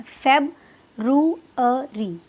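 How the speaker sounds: February very slowly, feb - roo - uh - ree; syllable by syllable, pausing inside February; broken into parts. Shown as a demonstration. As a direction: spell out February by sound for learners. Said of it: "तीन parts में इसको divide करना है".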